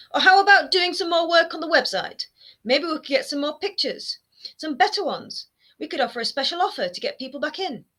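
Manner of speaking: mother whining about the website. A narrator's reading. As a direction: whine the suggestion at him. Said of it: "Her whining".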